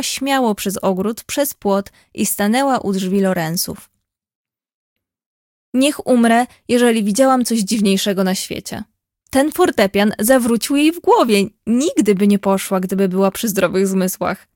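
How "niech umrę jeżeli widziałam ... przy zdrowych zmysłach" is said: ordinary delivery, nothing marked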